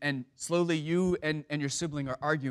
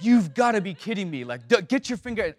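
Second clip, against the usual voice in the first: losing it; 5 semitones higher, 7 dB louder than usual. Retold or imitated. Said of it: "just goes off".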